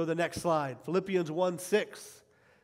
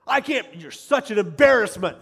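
loud, higher exasperated outburst; berating parent; 4 semitones higher, 11 dB louder than usual. Retold or imitated. Parent at a child's game, loudly berating the kid. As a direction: scold harshly, exploding on the key word. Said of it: "like some parents".

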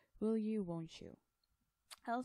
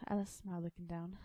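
ordinary delivery, nothing marked

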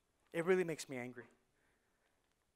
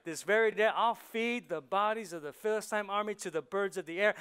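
loud, ringing proclamation; David's defiance; 3 semitones higher, 6 dB louder than usual. Acted. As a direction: declare it to the whole army.

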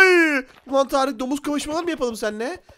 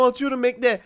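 ordinary delivery

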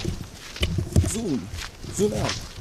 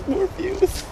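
much higher squeaky repeat. Shown as a demonstration. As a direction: squeak the line way up. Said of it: "repeat high and squeaky".

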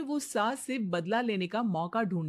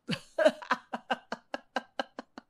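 laugh; 4 semitones higher than usual. Acted. Son laughs loudly.

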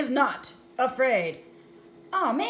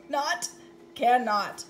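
ordinary delivery

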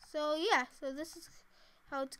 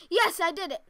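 loud, raised cry of success; triumphant; 4 semitones higher, 11 dB louder than usual. Real, not acted. Player celebrating a success in the game, triumphant.

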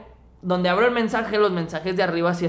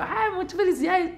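much higher, mock-sweet girly voice; mock sweet girl; 12 semitones higher, 5 dB quieter than usual. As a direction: chirp it like a sugary fan. Mimics girl giving birthday balloon.

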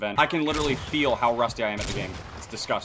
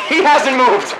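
laughing disbelief; 11 semitones higher, 12 dB louder, much slower than usual. Real, not acted. Laughing at a camper.

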